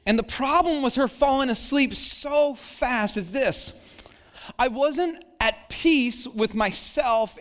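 preaching with rising intensity. Acted. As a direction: lift the pitch and preach it. Preaching build after story.

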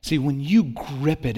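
ordinary talk

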